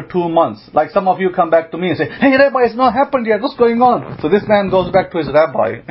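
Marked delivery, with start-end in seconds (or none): 2.0-4.2 higher-pitched impatient complaint; whiny complaint. Retold or imitated. Impatient students whining to the rabbi.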